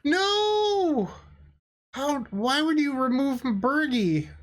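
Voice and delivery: high, drawn-out wail of despair; hammed-up heartbroken cry; mock anguish. Opponent removes their key creature.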